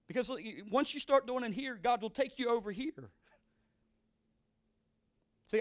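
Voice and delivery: rising sermon cadence, pressing each call; preaching. Acted. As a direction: urge the congregation on, line by line.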